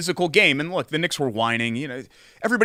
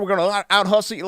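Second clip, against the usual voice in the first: put-on folksy country accent; 5 semitones higher, faster than usual. Host mocking a blue-collar team slogan, hammed-up country accent. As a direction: imitate an eager small-town booster in country accent. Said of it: "our friends that even have that accent".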